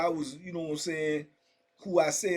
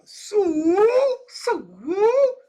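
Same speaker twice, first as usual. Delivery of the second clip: high, loud drawn-out imitation holler; hollered gang call; 8 dB louder than usual. Imitating Bloods calling out on the cell block, high holler.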